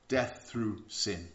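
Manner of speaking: death through sin drawn out, each word weighted; slow it right down and let it land; slow emphasis. Acted. Preacher repeating scripture to the congregation, slow and weighty.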